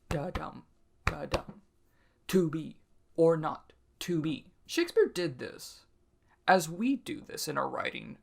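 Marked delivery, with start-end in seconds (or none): 0.1-1.6 soft da-DUM, da-DUM like a heartbeat; heartbeat rhythm. Shown as a demonstration. Teacher demonstrating the heartbeat meter, soft da-DUM. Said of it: "meant to be like a heartbeat". 2.3-4.5 to be or NOT to BE with a da-dum pulse; heartbeat stress. Shown as a demonstration. Teacher rereading Hamlet to show the heartbeat rhythm. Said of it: "meant to be like a heartbeat".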